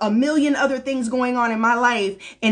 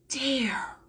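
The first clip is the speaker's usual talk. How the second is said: sudden whisper; 10 dB quieter than usual. Acted. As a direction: drop the one word to a whisper. Urgent warning to listeners.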